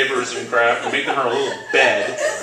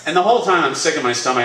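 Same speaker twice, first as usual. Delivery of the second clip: ordinary talk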